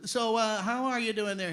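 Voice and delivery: a hint of a polite straight man; Jack Benny. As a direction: play the interviewer feeding a comedy bit. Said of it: "Jack would ask him questions".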